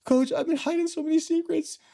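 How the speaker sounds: confessing to coach. Retold or imitated. A young player confessing secrets to his coach, high and pleading. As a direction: pour the secrets out, high and strained. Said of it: "I'm like".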